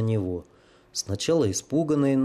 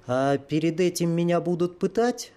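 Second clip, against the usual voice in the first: put-on higher, light young woman's voice; calm girl's question; 6 semitones higher than usual. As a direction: ask it almost casually. Prisoner asks about torture.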